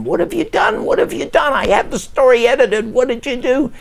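high, frantic outraged yelling; screaming. Retold or imitated. TV writer yelling at his producer over an altered story, screaming. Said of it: "I start screaming at him".

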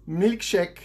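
MILKSHAKE said with a French accent; French-accented "milkshake", drawn out and emphatic. Shown as a demonstration. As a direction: say the English word "milkshake" as a French speaker would. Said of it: "You have to say it with a French accent".

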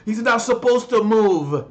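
hammed-up panicky neighbour; mock neighbour panic. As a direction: imitate her freaking out. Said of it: "she's like".

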